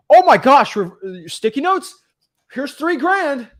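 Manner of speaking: high-pitched gushing of a sold buyer; hammed-up excitement, bursting in loud; mock amazed customer. Mimics gullible customers buying the pitch.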